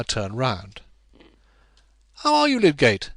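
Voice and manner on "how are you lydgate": lightly voiced young man, high and bright-toned; a hint of a cheery young gentleman; Will greeting. A narrator's reading. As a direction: call out a friendly greeting.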